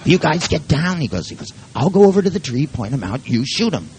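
lightly voiced dog commander, starting high; dog giving orders. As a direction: play a dog briskly directing the troops. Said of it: "even the dogs".

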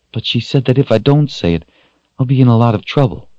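put-on high, fast child's voice; worried child. A narrator's reading. Rachel explains the princess's threat, worried child. Child fears getting in trouble.

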